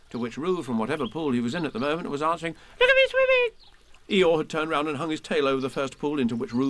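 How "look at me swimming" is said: put-on very high, loud baby squeak; little kangaroo showing off swimming; squeaky Roo. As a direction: squeak it with glee.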